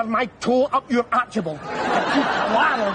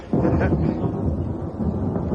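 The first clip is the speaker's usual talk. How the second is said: breathy laugh. Acted. Laughs after his joke.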